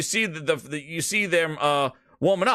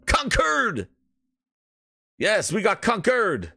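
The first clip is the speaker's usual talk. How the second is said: excited shout; 3 semitones higher, slower than usual. Real, not acted. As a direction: celebrate at full voice. Hype intro, triumphant yelling.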